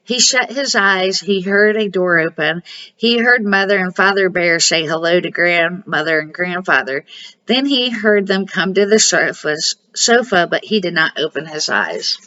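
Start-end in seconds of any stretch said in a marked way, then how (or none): none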